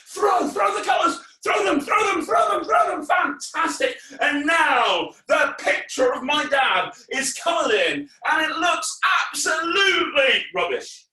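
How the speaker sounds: high, loud rallying shouts; excited shouting. Acted. Entertainer urges children to throw invisible colours, shouting. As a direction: whip the crowd up.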